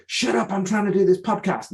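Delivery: hammed-up annoyed shush; mock snapping parent. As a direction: play the irritated parent telling the kids to shut up. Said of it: "we could turn around and go".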